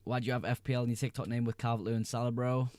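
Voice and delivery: ordinary talk, nothing marked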